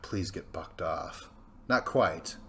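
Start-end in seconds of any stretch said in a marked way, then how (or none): 0.0-1.3 hushed, slow whispered wish; sneaky whispered hope. Retold or imitated. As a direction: breathe it out secretly. Rival hoping rider falls.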